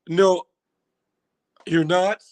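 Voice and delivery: ordinary delivery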